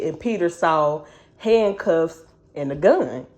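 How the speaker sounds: normal talking voice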